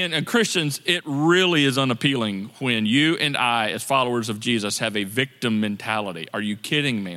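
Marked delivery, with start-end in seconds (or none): none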